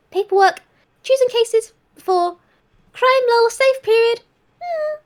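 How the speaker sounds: a cheerful little house spirit cooing; chirpy spirit voice praising the tea; high sing-song spirit creature. Acted. Network voice.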